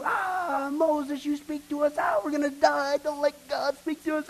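high, pleading mock panic; the terrified crowd pleading at the mountain; panicked people. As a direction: beg Moses to shield them. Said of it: "what did people do?".

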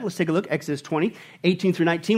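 ordinary talk, nothing marked

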